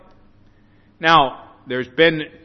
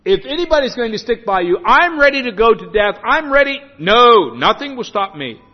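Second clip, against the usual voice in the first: boastful vow; 4 semitones higher, 6 dB louder than usual. Acted. Peter boasting he will never fail.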